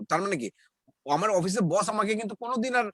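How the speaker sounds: normal talking voice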